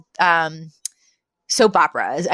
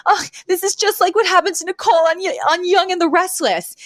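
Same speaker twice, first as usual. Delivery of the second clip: much higher, fast, nasal-free put-on Fran whine; Fran's frantic plea; 10 semitones higher than usual. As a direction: blurt it out in a panicky high character voice. Fran pleads while being arrested.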